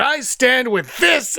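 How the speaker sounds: high, slow, drawn-out declaration; mock proclamation. Imitating everybody on Twitter taking a side, mock-solemn proclamation. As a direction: proclaim it like a grand pledge, stretched out.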